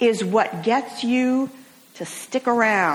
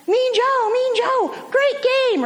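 put-on much higher, bright child's voice calling after his hero; eager little boy; 13 semitones higher, slower than usual. Little boy catches up to the limping football star, high eager child voice. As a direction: sound like a kid chasing his idol. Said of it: "this little boy catches up and says".

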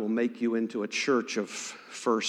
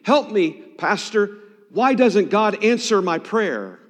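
loud, raised-pitch desperate demand; frustrated plea, high and forceful; angry pleading; 6 semitones higher, 5 dB louder than usual. Retold or imitated. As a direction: cry out angrily to the pastor for help. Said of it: "He said, very angrily".

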